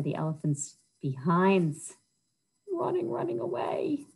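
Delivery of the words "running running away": high, soft whisper. A narrator's reading. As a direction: breathe it out playfully. Elephants flee.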